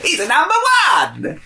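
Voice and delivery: high, loud put-on voice; hammed-up impression; mock Wiseau voice. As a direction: keep the strained movie voice going. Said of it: "they said".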